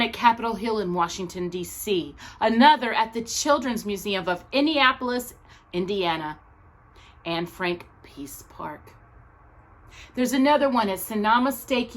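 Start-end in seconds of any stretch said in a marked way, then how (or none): none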